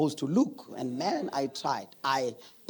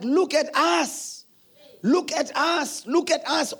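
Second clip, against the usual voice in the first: rallying cry; 9 semitones higher, slower than usual. Retold or imitated. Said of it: "And I was like".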